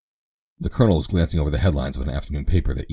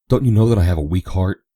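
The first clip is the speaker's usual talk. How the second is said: higher, louder hammed-up dismay; mock alarm; 4 semitones higher, 6 dB louder than usual. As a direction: pretend to be faint with shock.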